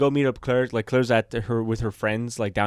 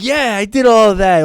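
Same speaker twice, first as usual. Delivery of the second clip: imagined defensive retort; 8 semitones higher, 10 dB louder than usual. Retold or imitated. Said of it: "And I'd be like".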